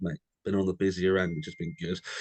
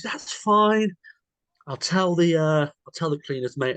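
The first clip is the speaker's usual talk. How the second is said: high, loud mimicked reassurance; high put-on voice, easygoing reply; imitated obliging host; 9 semitones higher, 4 dB louder, slower than usual. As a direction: play the host brushing it off in a pitched-up voice.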